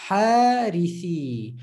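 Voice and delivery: Harithi slowly, each sound long; slow drawn-out Harithi. Shown as a demonstration. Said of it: "take the letter of mad".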